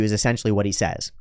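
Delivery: ordinary talk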